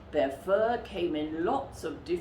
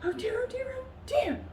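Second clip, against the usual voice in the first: high, fretting polar bear voice; a flustered big bear fussing to herself; fretful muttering; 12 semitones higher, faster than usual. A narrator's reading. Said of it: "she muttered to herself".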